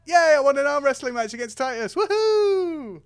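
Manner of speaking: high, loud, fast squeal of delight; gleeful cheer. Acted. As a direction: celebrate like you won a trophy. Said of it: "You're just like".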